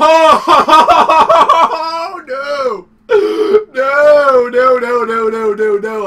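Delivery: loud panicked pleading. Real, not acted. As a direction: beg in rising panic. Monster attacks, panicked pleading.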